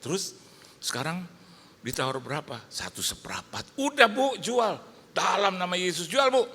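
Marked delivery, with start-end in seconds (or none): 2.7-3.8 whispered figure. Retold or imitated. Woman tells the offer. 3.8-6.4 much higher, louder push; excited urging. Retold or imitated. Pastor urging the woman on the phone to sell, excited.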